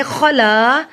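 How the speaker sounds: "خَلَا" with a rasped kh and long aa; strong kha sound. Shown as a demonstration. Teacher asking for the kha sound, "خَلَا" with rasped kh. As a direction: scrape the kh of "خَلَا", then hold the vowel. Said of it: "خا کی آواز نکالیں".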